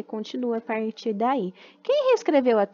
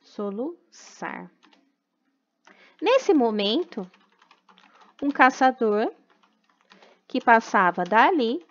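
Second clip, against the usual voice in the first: put-on slow, even reading for children to write down; slow dictation; slower than usual. Teacher dictating a rewritten story to the class, slow and deliberate. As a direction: read it out word by word so the class can copy it.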